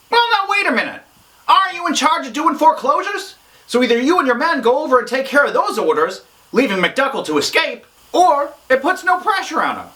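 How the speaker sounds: high-pitched character voice raising an objection; protesting objector. Acted. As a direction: break in and argue in a high voice. Objects to the foreclosure plan.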